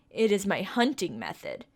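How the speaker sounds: ordinary talk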